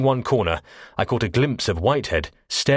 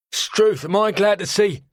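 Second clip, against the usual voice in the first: relieved cockney sailor; 13 semitones higher, 4 dB louder than usual. Acted. Relieved cockney quartermaster voiced.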